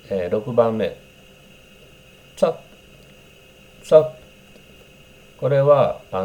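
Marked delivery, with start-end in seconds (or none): 2.4-4.2 What's up clipped so the "wha" almost vanishes, drawn out slowly; slurred what's up. Shown as a demonstration. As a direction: swallow the first sound of What's up. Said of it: "和がほとんど聞こえない". (Whatの音をほとんど発音しない)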